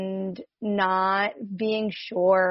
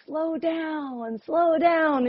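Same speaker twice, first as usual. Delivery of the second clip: high, lightly mock-wise voice of the universe; a gentle cosmic nudge, pitched up; 8 semitones higher, much slower than usual. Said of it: "the universe saying".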